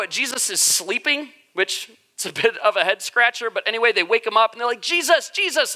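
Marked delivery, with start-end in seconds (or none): 4.8-5.7 mock-panicked disciples calling high; urgent, frightened high-pitched calling. Disciples waking Jesus in the storm, panicked calling. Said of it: "and they're in a panic".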